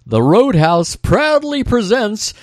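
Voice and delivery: high, slow, drawn-out MC introduction; mock stage announcer. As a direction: announce the act with showy flourish, stretching the name. Imitates the Roadhouse announcer introducing band.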